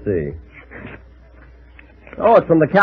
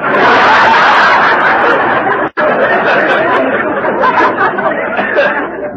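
laugh; 13 semitones higher than usual. Real, not acted. Laughs at Remley joke.

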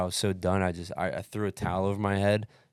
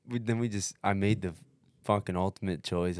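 ordinary talk

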